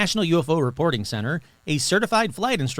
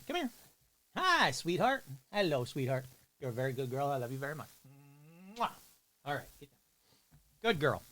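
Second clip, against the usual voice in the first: soft, much quieter cooing; 3 semitones lower, 12 dB quieter than usual. Real, not acted. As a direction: owner sweet-talking the dog, soft and tender. Talking softly to pet dog.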